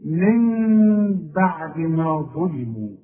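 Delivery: slow, drawn-out nasal ghunna on من; tajweed recitation. Shown as a demonstration. Teacher recites Quran example for students, slow ghunna. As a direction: hold the ghunna in من as the meem-like hum, slowly. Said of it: "اسمع تاني".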